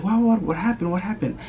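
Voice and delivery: high, urgent repeated questions; imitated alarmed mom. Mom asking crying kids what happened, high and alarmed. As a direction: play mom startled by the kids. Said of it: "And she's like".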